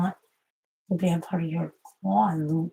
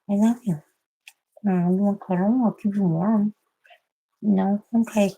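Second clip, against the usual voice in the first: higher, louder doting coo; shower the cat with love; higher doting voice for a pet; loving pet talk; 4 semitones higher, 5 dB louder than usual. Real, not acted. Cooing and cuddling the cat.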